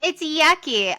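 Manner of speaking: high, loud mock-kid protest; childish complaint. Co-host joins in mocking the husband as a child, childish complaint. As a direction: play a little kid refusing, high and loud. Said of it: "he's like the tenth child".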